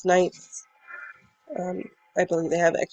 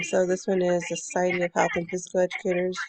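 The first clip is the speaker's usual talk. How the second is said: normal talking voice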